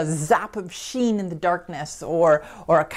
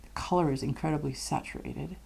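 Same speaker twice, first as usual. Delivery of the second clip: whispering; 2 semitones lower, 7 dB quieter, faster than usual. Real, not acted. Close look at pastel.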